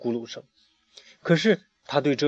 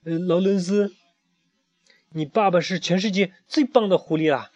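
higher, cheerful duck voice; happy duck; 6 semitones higher than usual. A narrator's reading. Emma praises the fox father, happy higher duck voice. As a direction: chirp it out, pleased as can be. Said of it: "艾玛高兴地说".

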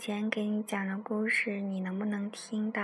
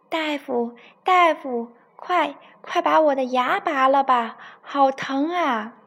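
loud, higher desperate calling at the door; shouting for the doctor; 5 semitones higher, 9 dB louder than usual. Acted. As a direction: bang on the door and yell for help. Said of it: "一边叫着". (老虎牙疼得在门口大喊大夫)

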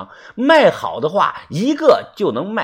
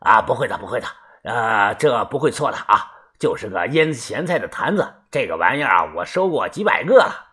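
dismissive dealer, a little lower; lightly voiced antique dealer; 6 semitones lower, 4 dB quieter than usual. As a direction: brush off the doubt, lightly in character. (略低沉、敷衍笃定的贩子口吻)

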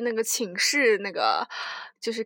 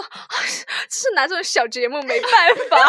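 ordinary delivery

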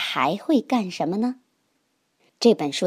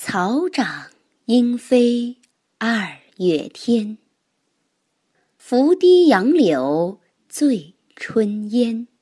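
slow, measured poem reading; poem recitation; slower than usual. Acted. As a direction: recite it slowly, line by line. Reads a classical poem thrice.

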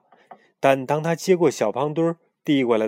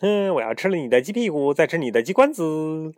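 high crazed yelling; crazed shout; 6 semitones higher, 4 dB louder than usual. Acted. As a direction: yell it like a madman. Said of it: "还继续喊".

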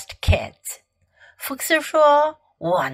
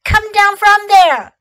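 high, loud order; crying out; 7 semitones higher, 6 dB louder than usual. A narrator's reading. Fox orders climbing kids down, loud cry. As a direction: shout up at climbing children. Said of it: "cried Fox".

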